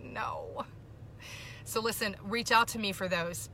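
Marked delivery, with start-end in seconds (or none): 0.0-0.7 whispered, voiceless no; hushed disbelief. Retold or imitated. Reacting to a mind-blowing list, whispered disbelief. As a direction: let it out as a stunned whisper. Recalls own shocked reaction.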